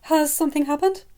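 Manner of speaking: high, anxious question; worried. Acted. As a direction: press for news, fearing the worst. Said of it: "clearly worried".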